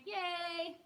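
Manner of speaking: high, loud squeal of joy; bright little yay; cheer. Real, not acted.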